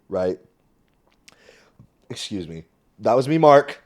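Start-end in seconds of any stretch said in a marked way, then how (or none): 2.1-2.6 unvoiced quick apology; a quick whispered excuse me mid story. Real, not acted. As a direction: whisper it aside. Quiet apology after a noise.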